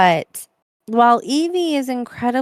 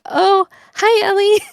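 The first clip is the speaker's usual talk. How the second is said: sheepish hi; 10 semitones higher, 4 dB louder than usual. Retold or imitated. Greeting the yelling cat.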